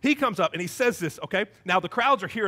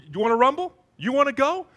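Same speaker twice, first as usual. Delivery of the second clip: higher, rising mock-tough taunt; gang challenge; 6 semitones higher, 4 dB louder than usual. Rival musical gangs squaring off for a rumble, mock gang challenge. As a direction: challenge them like a stage gang. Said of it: "these two rival gangs".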